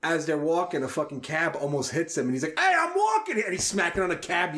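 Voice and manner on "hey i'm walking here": high-pitched, indignant New York holler; imitated New York yell. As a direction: yell it like at a cab driver, in New York accent. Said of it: "yelling at the guy".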